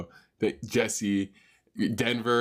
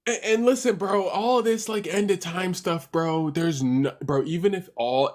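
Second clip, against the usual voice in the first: worked-up protest; 9 semitones higher than usual. Real, not acted. Streamer argues with the AI, starting high and heated, then easing. Streamer pushing back on the doom.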